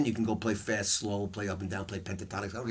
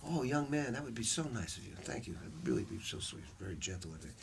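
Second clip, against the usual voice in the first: gracious thanks; 9 dB quieter than usual. Retold or imitated. Bird graciously answers the young player.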